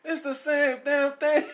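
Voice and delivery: high, loud, drawn-out protest; loud exasperation. Real, not acted. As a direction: protest loudly, stretching each word. Bickering over whose phone it is.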